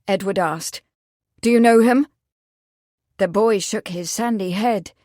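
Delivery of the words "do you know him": urgent. A narrator's reading. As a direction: press for the name. Urgent question.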